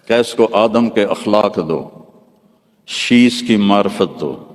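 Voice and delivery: slow, measured litany with each دو landing firmly; preaching litany. Acted. Preacher listing each prophet's gift in a slow, solemn litany. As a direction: build a solemn chant of blessings. Rhythmic list of prophets' gifts.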